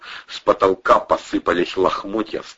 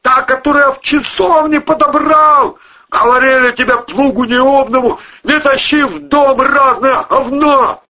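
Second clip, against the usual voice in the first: high, very loud scolding; angry yelling; 7 dB louder than usual. A narrator's reading. Man blaming his companion for the monster, yelling. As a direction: yell the blame at full volume. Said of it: "орал Вовчик".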